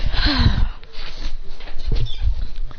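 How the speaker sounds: long breathy exhale; drawn-out acted sigh. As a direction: let out a long, voiceless breath. Voiced effect as he transforms.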